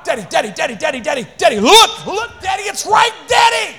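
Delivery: rising, eager calls to daddy; excited child calling. Acted. Isaac calling his father to look at the ram, growing excited.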